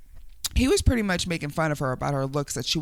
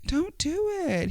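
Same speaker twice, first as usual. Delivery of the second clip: high warning; 12 semitones higher than usual. Real, not acted.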